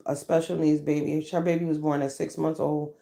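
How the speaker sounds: ordinary talk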